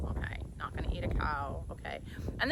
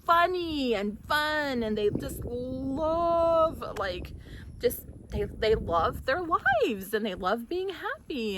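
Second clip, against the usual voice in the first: moved delight; 7 semitones higher, slower than usual. Real, not acted. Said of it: "I'm getting a little emotional".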